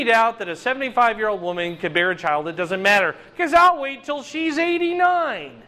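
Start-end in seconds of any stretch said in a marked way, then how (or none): 3.4-5.6 higher, teasing voice of God; playful God. Retold or imitated. God explaining his waiting, higher and teasing. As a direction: tease it like a playful aside. Voicing God's plan.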